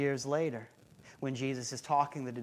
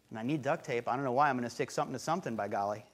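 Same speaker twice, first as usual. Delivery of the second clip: mock bumbling shopper; 5 semitones lower, 4 dB quieter, much faster than usual. Mocking clueless shopper.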